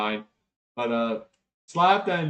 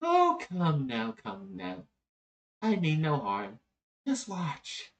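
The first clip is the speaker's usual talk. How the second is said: cajoling game character; 6 semitones higher, slower than usual. Acted. Voices a figure in the game.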